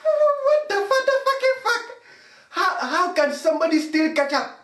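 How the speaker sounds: high squeaky mock bewilderment; confused people reacting to a ketchup thief, high and squeaky; baffled onlooker. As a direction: play a stunned bystander, voice jumping way up. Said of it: "People will be like".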